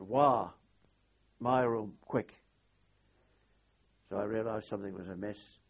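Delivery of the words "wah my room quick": louder, urgent retold call; retold shout. Squadron commander shouting to the narrator to come quick, retold shout. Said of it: "and he shouted".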